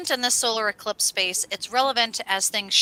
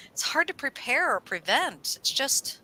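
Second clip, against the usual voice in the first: usual speaking voice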